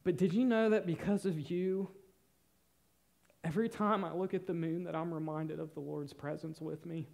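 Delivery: ordinary talk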